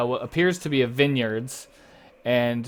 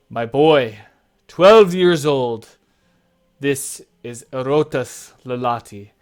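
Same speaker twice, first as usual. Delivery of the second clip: loud, stretched-out presentation of a son; proud father; 9 dB louder than usual. Acted. Father introducing his twelve-year-old boy, proudly proclaiming. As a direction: announce your boy to honored guests. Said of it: "And he says".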